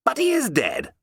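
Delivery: high, insistent exclamation; cried out. Acted. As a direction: cry out that he is dead, pitch leaping up. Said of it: "I cried".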